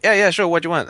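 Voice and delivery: high, loud, eager reply; acted-out breezy willingness, pitched right up; eager helper. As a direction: jump in keen to help. Acting out eager reply to tourist.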